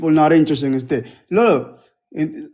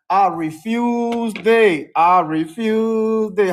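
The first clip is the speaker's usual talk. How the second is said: slow, firm refusal; much slower than usual. Real, not acted.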